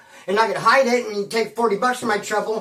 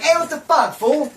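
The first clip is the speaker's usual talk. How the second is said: put-on higher, louder challenge at the boyfriend; retold confrontation; 6 semitones higher, 5 dB louder than usual. Confronting the boyfriend in Glenna's house, a sharp loud challenge. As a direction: call him out hard.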